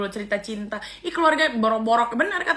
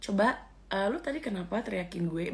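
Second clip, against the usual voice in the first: much softer, calm and polite; polite quiet request; 2 semitones lower, 10 dB quieter than usual. Retold or imitated. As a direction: show how to ask nicely and quietly. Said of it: "ngomong aja". (tiruan permintaan sopan dengan suara jauh lebih pelan)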